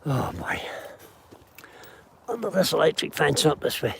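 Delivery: out of breath from the hill climb. Real, not acted. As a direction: puff the words after a climb. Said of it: "Excuse me while I'm short of breath".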